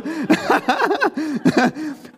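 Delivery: a high, open laugh; hearty laugh. Real, not acted. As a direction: let the laugh out fully. Laughing after his punchline.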